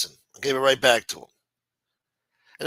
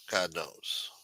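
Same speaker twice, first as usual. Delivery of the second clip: God knows repeated low and much softer; muttered aside; 8 semitones lower, 10 dB quieter than usual. Real, not acted. Repeats phrase, second fades.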